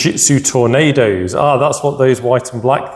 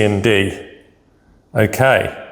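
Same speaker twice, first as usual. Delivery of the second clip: ordinary delivery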